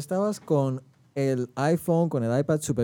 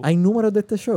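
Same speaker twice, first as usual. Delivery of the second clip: surprised; 7 semitones higher, 6 dB louder than usual. Real, not acted. Surprised at show statistics.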